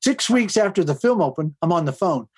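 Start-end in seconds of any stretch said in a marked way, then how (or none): none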